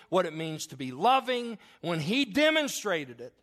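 loud, slow, rising preacher's cry; full-force preaching. Acted. Preacher firing up the fathers in his church, loud and slow.

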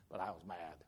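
very low, soft understatement; muttered admission. Retold or imitated. Pastor admits anger after comforting secretary, low muttered aside. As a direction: drop it right down under the breath.

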